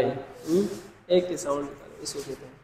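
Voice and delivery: ordinary talk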